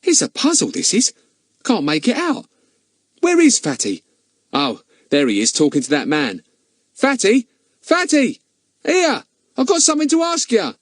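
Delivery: very high-pitched lad, calling out; high boyish voice, urgent; puzzled, excited boy. Acted. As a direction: pitch it way up, baffled then hailing a friend. Ern puzzled, calls his friend.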